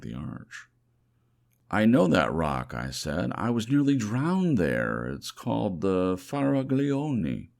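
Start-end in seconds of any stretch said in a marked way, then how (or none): 1.7-2.7 loud, sudden burst of recognition; excited recognition. Acted. Narrator recognizing the rock where he nearly drowned, loud and excited. 3.3-7.5 loud and higher, eager to tell; excited recognition. Acted. Dreamer recognizes the rock.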